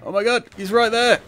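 high panicked shouting; panicking gamer. Real, not acted. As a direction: yell it in a frantic panic. Panicked shouting at enemy soldier.

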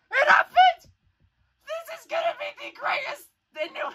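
very high squeal; overwhelmed with joy. Real, not acted. Fan seeing the hoped-for trailer, overwhelmed with joy. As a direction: squeal it, overcome with excitement.

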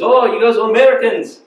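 loud, high attempt at an Egyptian accent; bad Egyptian accent. Egyptian man greets American visitors in museum, bad Egyptian accent. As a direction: exclaim in a badly faked Egyptian accent. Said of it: "That's a really bad Egyptian accent".

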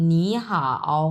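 "নি হাও" drawn out, rising "নি" then low "হাও"; deliberate "নি হাও", falling low on "হাও"; tone change demo. Shown as a demonstration. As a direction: model "নি হাও" as second plus third tone. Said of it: "উচ্চারণ তখন এইভাবে হয়ে যাবে".

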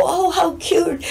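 high, slow mock gush; gushing man. Men at the care home gushing over her outfit, slow high mock gush. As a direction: stretch it out like a smitten fan. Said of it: "the guys will all go".

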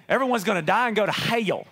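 mock legalist drawing out "hell" with an extra syllable; mock hellfire verdict, "hell" said as "hay-ul"; angry, super-holy preacher. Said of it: "That's how we say it".

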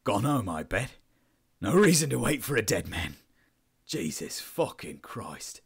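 bitter, broken-down exclamation; between laughing and sobs. A narrator's reading. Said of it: "somewhere between the laughing and the sobs".

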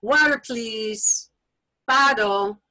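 slow, clear example phrase; natural demo phrase. Shown as a demonstration. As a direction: say it slowly for a child. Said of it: "Another option might be".

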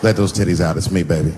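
low coaxing smooth voice; seductive husband. Retold or imitated. Husband sweet-talking his mad wife at bedtime. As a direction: sweet-talk her low and easy. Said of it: "cover their titties up".

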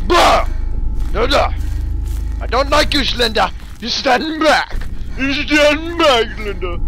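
high, loud frantic shouts; panicked yelling. Real, not acted. Player ordering Slender to stand back, panicked shouting. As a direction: yell in alarm to keep it away.

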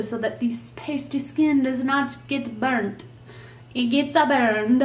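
playful, unsure attempt at a Mexican accent; muddled Mexican accent. Daughter joking about pasty skin burning, in a botched Mexican accent. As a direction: slip into a hammed-up, vaguely Mexican accent. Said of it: "I don't know what accent that was".

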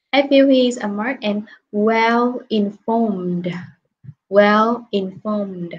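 very slow, careful "informed" ending in a clear d; slow model reading. Shown as a demonstration. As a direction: read it slowly, landing the d in "informed". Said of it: "E D này sẽ được phát âm là đờ".

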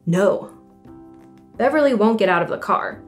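ordinary delivery, nothing marked

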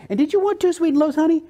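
high, quick, sweet voice for Ruby; sweet drive-thru lady. Retold or imitated. Speaker imitating a friendly drive-thru waitress, high and quick. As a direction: chirp it like a kind waitress. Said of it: "She says".

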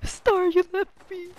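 high, slow whiny wail; high, slow mock-wounded whine. Real, not acted. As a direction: moan it like you were betrayed. Complaining teammate abandoned them.

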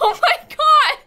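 very high, loud cry of disbelief; high-pitched incredulous outburst; shocked shout. Real, not acted. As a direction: shriek it in exasperated disbelief. Disbelief at repeated villager.

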